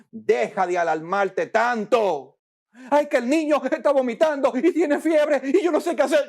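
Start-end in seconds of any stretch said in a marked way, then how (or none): 2.9-6.3 higher, fast, half-laughing fretting; mock a flustered mom rattling off worries; preacher mimicking a panicked mother, fast and high.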